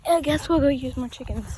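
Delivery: usual speaking voice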